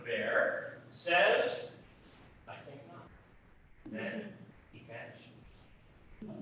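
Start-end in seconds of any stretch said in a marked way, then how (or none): 2.5-6.4 quiet. Acted. Punchline trailing to silence.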